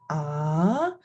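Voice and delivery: "Ah" swept upward like a question; rising second tone. Shown as a demonstration. Teacher shows the second tone, questioning rising "Ah". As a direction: start low, lift "Ah" into a question. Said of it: "you say in a question tone".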